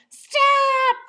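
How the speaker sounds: high, loud shout; loud yell. A narrator's reading. Girl yelling at the man lifting her by the hair. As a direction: yell it as loud as you can. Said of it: "yelled very loudly".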